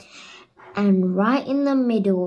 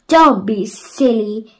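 loud, slow scoffing; dismissive exclamation; 8 dB louder than usual. A narrator's reading. The mayor scoffing at Peter, loud and dismissive. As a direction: wave Peter off with scorn. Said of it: "exclaimed the mayor".

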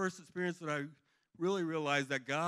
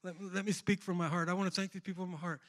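pleading prayer; 5 semitones higher, 3 dB louder than usual. Retold or imitated. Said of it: "I'm praying".

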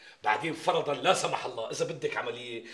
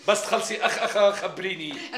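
mocking her groans; 7 semitones higher, 8 dB louder than usual. Neighbour mocks her moaning.

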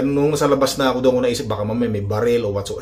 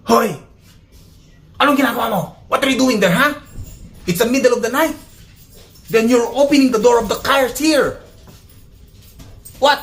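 much higher, halting pretend scolding; pitched-up mock anger at the thief; mock confrontation; 10 semitones higher than usual. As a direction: play-act catching a thief in broken English. Confronting imagined car thief.

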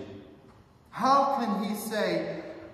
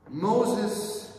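ordinary talk, nothing marked

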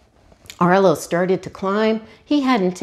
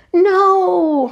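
much higher, louder cry of alarm; desperate shout; 12 semitones higher, 7 dB louder than usual. A narrator's reading. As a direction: yell as the berries roll away. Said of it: "Arlo shouted".

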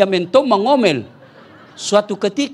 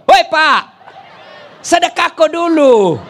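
loud call across the house, voice high; 8 semitones higher, 5 dB louder than usual. Retold or imitated. Wife calling loudly after her husband as he leaves. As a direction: yell to her husband to hand over his kiss.

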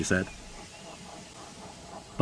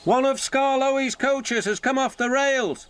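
calling out. Acted. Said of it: "called Duncan's driver".

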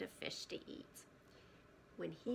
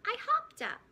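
very high, loud proud squeak; tiny bird's thrilled high cry; excited squeal; 12 dB louder than usual. Acted. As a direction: burst out with pride. Baby bird proudly answers.

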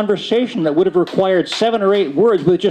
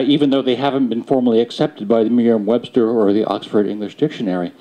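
ordinary delivery

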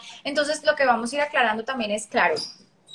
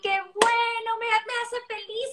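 sugary praise; 7 semitones higher than usual. Retold or imitated. Parent praising toilet success, mock sing-song sweetness. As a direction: coo it over-sweetly like rewarding a toddler.

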